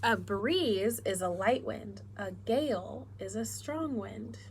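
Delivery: slow, clear storybook reading; reading to children. Acted. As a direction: read it slowly to little kids. Reads picture book to class.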